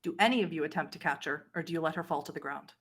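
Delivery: ordinary talk, nothing marked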